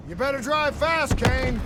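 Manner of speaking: shouted high and loud over engine noise; shouting over the chase. Acted. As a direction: yell it across the boat, urgent. Airboat chase under fire.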